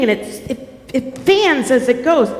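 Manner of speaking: ordinary delivery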